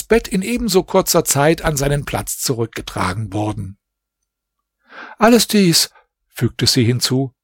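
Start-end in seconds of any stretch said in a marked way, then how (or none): 5.2-5.9 higher, younger princess voice, still rattled; a frightened young princess pleading with her mother; shaken princess. A narrator's reading. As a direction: princess voice, raised pitch, anxious. Princess resumes her account.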